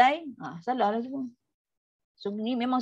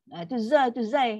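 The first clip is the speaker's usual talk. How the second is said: ordinary delivery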